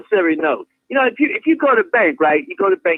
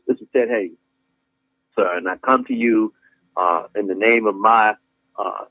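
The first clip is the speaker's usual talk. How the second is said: much lower, formal address to the mayor; citizen addressing mayor; 13 semitones lower than usual. Retold or imitated. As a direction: present yourself low and formally. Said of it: "you identify yourself".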